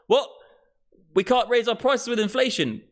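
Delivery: high, baffled mock company voice; panicked company. Energy company balking at the price cap, baffled and high. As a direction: play the stunned energy firm, voice jumping up. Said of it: "and the company's like".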